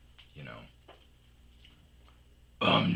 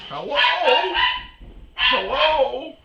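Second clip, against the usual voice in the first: calling out; 9 dB louder than usual. Retold or imitated. Stranger calling into house.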